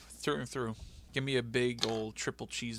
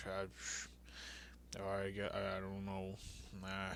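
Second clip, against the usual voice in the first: much softer, deflated and unsure; mopey mumble; 3 semitones lower, 13 dB quieter, slower than usual. Retold or imitated. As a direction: mumble it like you've given up.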